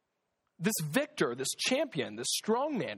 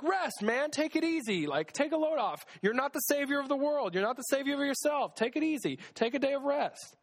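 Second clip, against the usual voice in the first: easygoing reassurance; 7 semitones higher than usual. Retold or imitated. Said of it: "sometimes God's like".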